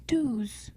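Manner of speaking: ordinary talk, nothing marked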